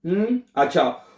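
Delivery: ordinary talk, nothing marked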